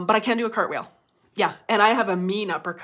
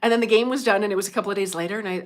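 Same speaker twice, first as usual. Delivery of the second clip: usual speaking voice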